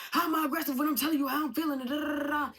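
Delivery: loud, high, rapid-fire mock rant; aggressive ranting. Imitating a man on a TikTok live denying he's aggressive, aggressive yelling. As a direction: yell it indignantly, racing through. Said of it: "You sound aggressive".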